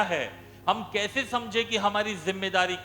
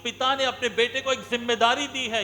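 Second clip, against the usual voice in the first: loud, raised preaching voice; scripture proclamation; 4 semitones higher, 3 dB louder than usual. Acted. Preacher proclaiming salt and light to church, forceful. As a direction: declare it to the whole church.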